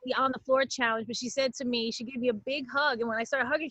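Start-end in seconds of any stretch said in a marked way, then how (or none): none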